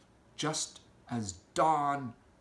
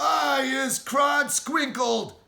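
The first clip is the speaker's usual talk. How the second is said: slow, much higher, loud yowl; yowling giant; 12 semitones higher, 8 dB louder, slower than usual. Acted. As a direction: yowl like a trapped beast. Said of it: "yowled the blood botter".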